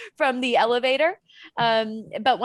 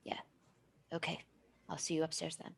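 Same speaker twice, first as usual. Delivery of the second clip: quiet aside; 5 semitones lower, 14 dB quieter than usual. Acted. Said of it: "hear him say".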